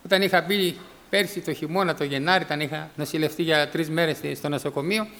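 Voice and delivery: ordinary talk, nothing marked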